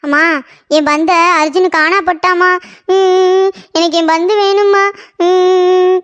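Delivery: drawn-out tearful whine to mother; acted childish sobbing; crying. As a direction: wail the complaint slowly, with whimpers. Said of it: "ஏன்டி அழுதுகிட்டு இருக்க".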